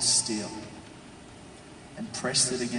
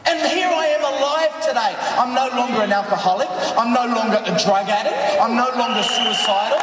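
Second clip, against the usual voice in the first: high, loud triumphant testimony; full-force preaching; 14 semitones higher, 8 dB louder than usual. Real, not acted. Preacher telling the crowd his life was restored, triumphant shouting. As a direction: proclaim each line to the crowd, shouting.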